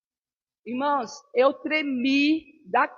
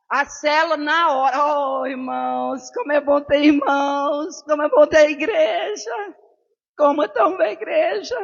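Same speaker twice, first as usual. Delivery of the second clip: louder, slow and choked, near tears; tearful gratitude; 2 semitones higher, 5 dB louder than usual. Real, not acted. Mother retelling son's crisis, crying with gratitude. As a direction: voice breaking with thankfulness for the church. Overcome thanking God for church.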